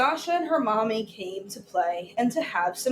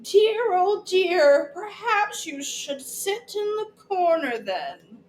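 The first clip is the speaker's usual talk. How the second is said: ballet teacher voice; 8 semitones higher than usual. Acted. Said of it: "Miss Louisa said".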